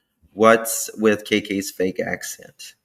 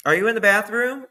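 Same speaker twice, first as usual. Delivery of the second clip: high, raised questioning call; 10 semitones higher, 5 dB louder than usual. Acted. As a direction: act a parent calling to a child. Acts parent calling for child.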